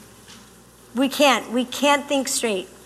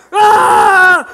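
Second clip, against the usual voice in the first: loud, high frustrated yell; mock angry roar; 6 semitones higher, 10 dB louder than usual. Imitating an angry man's outburst, a loud roar. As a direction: blast out an angry grunt. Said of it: "you're like".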